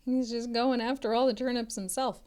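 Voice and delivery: ordinary delivery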